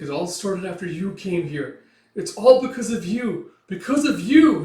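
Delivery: building to a high, loud outburst; acted anger, rising as it goes; bitter accusation. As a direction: hurl the blame at them. Teresia blames Guts, furious.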